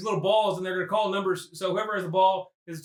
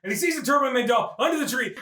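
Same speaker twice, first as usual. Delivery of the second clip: normal talking voice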